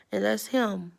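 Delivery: ordinary delivery, nothing marked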